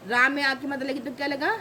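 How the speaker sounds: usual speaking voice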